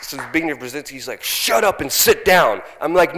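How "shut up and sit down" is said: slow, loud imitated command; mock stern order. Brzezinski telling the heckler to sit down, loud mock order. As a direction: bark it like an annoyed official.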